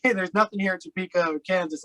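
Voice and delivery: normal talking voice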